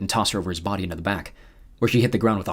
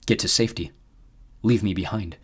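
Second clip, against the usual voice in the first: ordinary delivery, nothing marked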